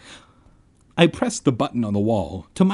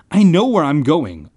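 louder, higher, defiant call; calling back; 4 semitones higher, 7 dB louder than usual. A narrator's reading. Boy answering friend down the hall, calling back. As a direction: call it back over your shoulder. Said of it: "I called back".

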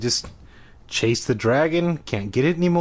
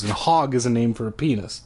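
plain reading voice

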